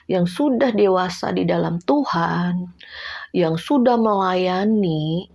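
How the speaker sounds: slow, weighty sermon stress; slow down to press the point; slow emphasis. Real, not acted. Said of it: "preaching emphasis". (penekanan lambat berkhotbah)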